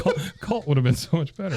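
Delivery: laughing it out; amused laughing aside. Real, not acted. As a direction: chuckle through the line.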